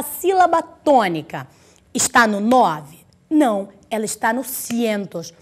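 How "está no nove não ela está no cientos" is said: ordinary delivery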